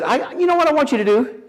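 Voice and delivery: high, loud mock wife voice; lightly voiced wife, pushing him; wife's bossy quote. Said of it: "She said".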